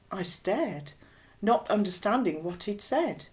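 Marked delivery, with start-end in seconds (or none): none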